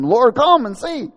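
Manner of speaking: high, loud, drawn-out call; mourners' plea. Acted. Mourners leading Jesus to the tomb, wailing call. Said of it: "And they said to him".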